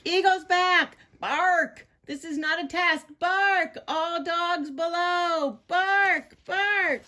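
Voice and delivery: very high, urgent watchdog calls; frantic high sentry shouts; alarm call. Acted. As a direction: sound the warning, punching each bark.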